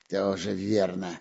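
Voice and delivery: slow, low drawl. A narrator's reading. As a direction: draw it out, puzzled. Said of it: "протянул Буль-Буль".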